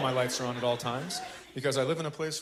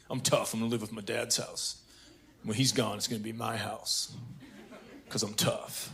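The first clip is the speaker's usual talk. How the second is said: low, soft tough-son voice; mock tough guy; 7 semitones lower, 12 dB quieter than usual. Son boasts he can live alone, mock tough and low. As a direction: play a son quietly boasting, pitched well down.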